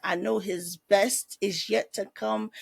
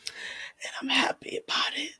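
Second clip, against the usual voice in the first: soft happy whisper; 5 semitones higher, 5 dB quieter than usual. Real, not acted. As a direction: breathe it out privately. Tender confession after tears.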